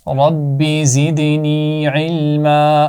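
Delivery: very slow, drawn-out prayer; slow recitation. Real, not acted. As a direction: intone each word slowly. Teacher recites prayer for knowledge. (آہستہ دعا کے انداز میں)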